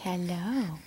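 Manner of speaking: low hushed hello; whisper. Real, not acted. Mom whispering hello to her newborn. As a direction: breathe a tiny hello.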